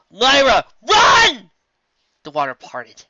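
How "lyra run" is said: high, loud urgent yell; loud panicked warning; shout. A narrator's reading. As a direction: scream at them to run.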